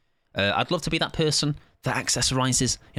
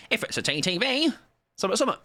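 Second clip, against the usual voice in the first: imitating streamer; 10 semitones higher, faster than usual. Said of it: "I said it in that voice".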